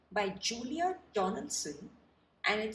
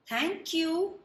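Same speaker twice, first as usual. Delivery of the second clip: giraffe voice; 8 semitones higher, 3 dB louder than usual. Acted. Giraffe thanks George.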